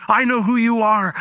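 retold crying out. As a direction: imitate a man crying out, without shouting. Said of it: "he's, he's crying out".

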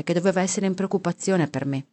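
ordinary delivery, nothing marked

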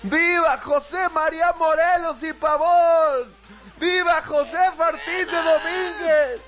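high, stretched patriotic cheers; tipsy shouting. Acted. Drunk official ending on his ex's name, shouting. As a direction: bellow the vivas after a few drinks. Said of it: "pasado de copas y termines gritando".

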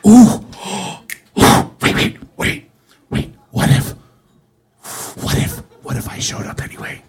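gasping, breath-only mock excitement; scheming whisper. Fan hatching a plan to return, excited stage whisper. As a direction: whisper as the idea dawns. Acting out a dawning idea.

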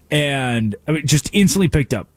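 usual speaking voice

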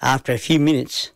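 usual speaking voice

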